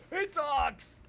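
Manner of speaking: put-on high, loud astonished child; amazed kid. Little boy hearing the owl talk, high and amazed. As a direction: gasp then marvel.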